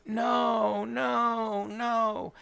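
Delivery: high, lightly voiced kid pleading; a mock child begging no, no, no; hammed-up little boy pleading; kid's pleading no. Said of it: "and the kid's going".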